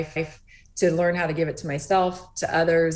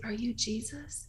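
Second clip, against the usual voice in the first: slow whisper; 4 semitones higher, 12 dB quieter, slower than usual. Retold or imitated. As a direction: repeat the whisper slower, rising at the end. Mother repeats question, daughter didn't hear.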